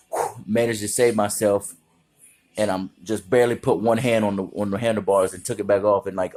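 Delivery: ordinary talk, nothing marked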